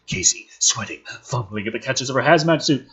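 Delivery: normal talking voice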